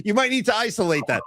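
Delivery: ordinary talk, nothing marked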